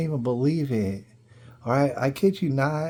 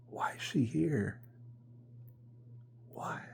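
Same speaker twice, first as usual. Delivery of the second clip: breathy unvoiced whisper, much softer; whispered aside; 15 dB quieter than usual. At the game, whispering his puzzled thought about the wife. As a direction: hush it like a private thought.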